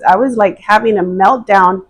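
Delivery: ordinary delivery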